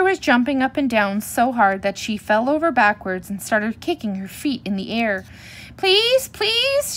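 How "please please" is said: put-on very high, squealed child pleas; loud shouted begging. A narrator's reading. Cubs begging in the parking lot, shouting loudly. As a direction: squeal each plea out loud. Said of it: "shouted the cubs so loudly".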